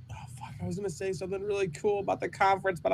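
fast frustrated whisper. Real, not acted. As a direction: hiss it out under your breath. Muttering frustration under breath.

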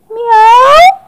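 very high, loud meow; cat meow. Acted. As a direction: mew like a small cat. Cat meows in story.

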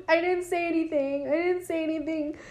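high, soft put-on voice; meek protest. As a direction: protest meekly, imitating a cowed woman. Voices Marianne protesting to someone unseen.

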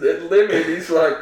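ordinary delivery

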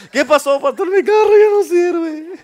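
put-on high, slow pleading old lady voice; whiny old lady. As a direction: play the helpless little old lady, stretched out. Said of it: "va a estar como la viejita".

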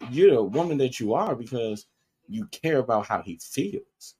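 ordinary talk, nothing marked

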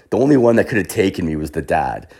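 usual speaking voice

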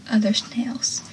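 soft, near-whispered realization; dawning realization. Retold or imitated. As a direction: let it sink in under your breath. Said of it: "blank look on Pacey's face as he realizes".

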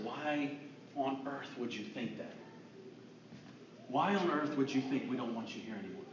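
low, soft disbelief; retold hushed, caring question; gentle reassurance. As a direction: reassure them tenderly and quietly.

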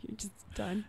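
softly whispered afterthought with a laugh; breathy, voiceless aside. Real, not acted. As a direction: whisper it to yourself. Repeats phrase as a whisper.

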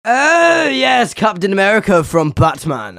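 a sudden high, loud burst of delight; mock excitement. Chef hams up his delight at the boy's mixed-up hero, loud. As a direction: gush as if it all makes sense. Chef's big exaggerated reaction.

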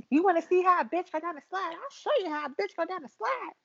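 high-pitched, sassy challenge; hammed-up bragging; boastful movie quote. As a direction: show off in a high put-on voice. Quoting Rasputia's slide scene.

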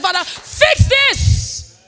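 high, slow shouted prayer; Father, fix this, stretched high and loud; crying out to God. Retold or imitated. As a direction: call up to heaven, pleading. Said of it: "I said".

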